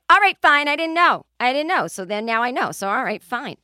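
hammed-up Boston woman huffing it off; huffy Boston snap. Embarrassed Boston passenger snapping at the pilot, Boston accent. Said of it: "just imagine a Boston person".

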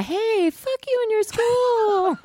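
high, drawn-out playful tirade; hollered high at an imaginary school; comic outburst, slow and high-pitched; mock defiance.

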